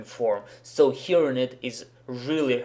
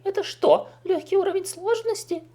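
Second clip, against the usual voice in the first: very high-pitched put-on voice; acted game-character line. Shown as a demonstration. Acts out corrected character line.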